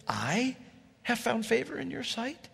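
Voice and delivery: "have found favor" rising high and soft in wonder; amazed question. Acted. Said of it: "She's blown away".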